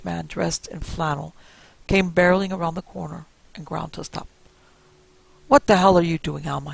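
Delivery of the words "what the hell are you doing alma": loud roar; old man roaring at his wife. A narrator's reading. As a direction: roar it at her in alarm. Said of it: "he roared".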